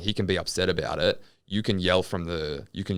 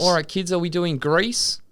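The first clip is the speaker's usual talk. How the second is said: teacher voice; 11 semitones higher, 3 dB louder than usual. Pretend. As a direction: address the class like a teacher. Teacher addressing kids.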